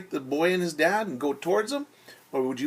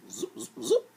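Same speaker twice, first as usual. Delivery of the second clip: breathy whispered magic zaps; soft whispered magic sound effect; whispered zoop effect; 8 semitones higher, 3 dB quieter than usual. Pretend.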